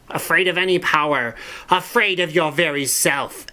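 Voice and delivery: high, sneering witch queen voice; scornful witch queen. Acted. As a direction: taunt him like a cruel witch queen. Witch queen mocks captive knight.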